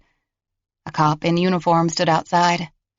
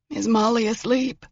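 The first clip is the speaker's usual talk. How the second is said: put-on higher, frail old-man tremble; trembling voice; 6 semitones higher than usual. A narrator's reading. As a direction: quaver feebly like a confused grandfather. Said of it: "his voice trembling".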